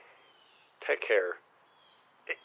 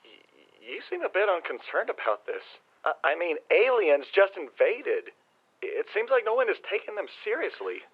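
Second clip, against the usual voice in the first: incredulous alarm; 6 semitones higher than usual. Acted. Brother worried, pressing on phone.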